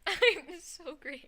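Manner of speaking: high, drawn-out mock self-praise; mocking echo. Echoing the other's "I'm so great", mocking. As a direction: parrot it back high and slow.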